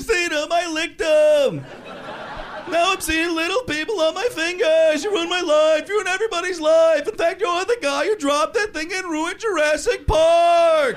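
hammed-up rant. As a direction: rant at him, high and loud. Ranting at Newman.

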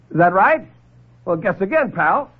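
high, tough-guy taunt; cocky sneer. Acted. Lieutenant sneers back at the doctor's threat. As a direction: call his bluff.

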